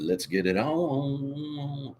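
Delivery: slow, stretched ring-announcer catchphrase; drawn-out announcer call. Shown as a demonstration. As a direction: draw it out like a boxing announcer. Catchphrase said twice.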